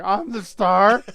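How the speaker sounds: high, loud, slow diva proclamation; mock diva. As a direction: belt it like a vain star.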